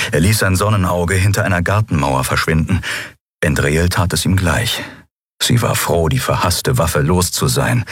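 ordinary delivery, nothing marked

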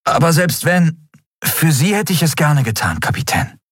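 put-on high, tender young voice; devoted. A narrator's reading. Miko tells his captain he would gladly die for her, tender.

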